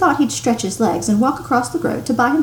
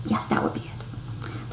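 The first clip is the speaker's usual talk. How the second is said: slow whisper to himself; whispered aside; 11 dB quieter than usual. A narrator's reading. Narrator voicing Dursley's self-reassurance as slow whisper. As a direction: breathe it as his private reassurance.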